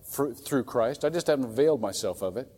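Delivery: usual speaking voice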